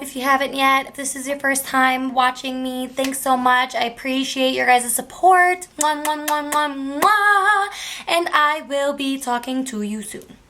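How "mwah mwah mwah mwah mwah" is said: singsong blown kisses, last one high; sung kiss sounds. Real, not acted. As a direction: blow kisses in a little tune. Blowing kisses to viewers at sign-off.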